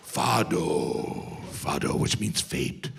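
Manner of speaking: mock gloom. Shown as a demonstration. Comic explaining fado, in mock gloom. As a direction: say it like a lament. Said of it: "moody demo of fado".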